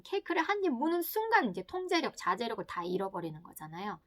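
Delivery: normal talking voice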